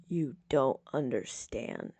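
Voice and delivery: soft, slow and worn out; a faint, exhausted protest; weakly. A narrator's reading. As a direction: barely have the strength to say it. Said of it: "he says weakly".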